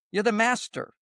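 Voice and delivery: ordinary delivery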